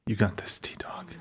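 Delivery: hushed whisper of support; whispered encouragement. Real, not acted. Host whispering encouragement to a stuck contestant, soft whisper. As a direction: breathe it softly to cheer them on.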